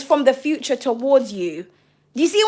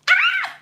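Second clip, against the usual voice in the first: high delighted shriek; excited shriek. Real, not acted. Reader thrilled that viewer is like Eleven, shrieking. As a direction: let out a thrilled scream.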